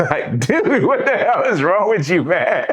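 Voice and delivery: high-pitched, through laughter; amused, incredulous, voice jumping up; laughing disbelief. Real, not acted. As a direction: say it cracking up, utterly baffled. Laughing at the crazy instructor.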